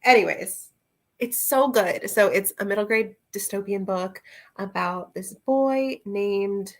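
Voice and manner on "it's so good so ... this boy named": ordinary talk, nothing marked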